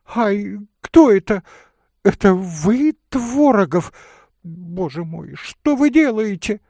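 high, loud, alarmed lady; startled alarm. Acted. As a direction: exclaim in shocked recognition. Lady startled by her lover. (испуганно, высоким громким голосом)

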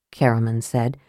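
usual speaking voice

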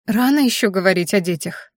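ordinary delivery

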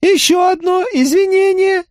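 firm, very high monotone alien; firm Crab voice. Acted. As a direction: state it firmly in a high flat creature voice. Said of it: "твёрдо объявил Краб".

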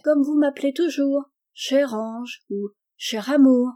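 a hint of a pleading little girl; child's plea. A narrator's reading.

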